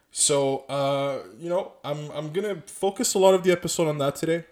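normal talking voice

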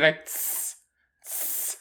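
hissing tss like paper tearing; imitated ripping noise. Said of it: "just keeps".

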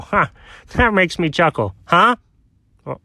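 ordinary delivery

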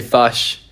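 "fush" with a short "uh" vowel; New Zealand vowel. Shown as a demonstration. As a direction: say fish as "fush", Kiwi style. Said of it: "And we say fush".

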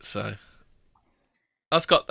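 ordinary talk, nothing marked

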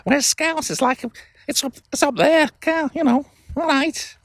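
exaggerated high Scouse caricature; high-register Scouse. Shown as a demonstration. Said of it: "you have to do this high register".

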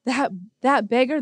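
ordinary talk, nothing marked

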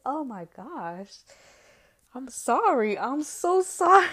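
high, loud, exaggerated fake sympathy; sarcastic mock apology. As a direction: whine an over-the-top fake sorry.

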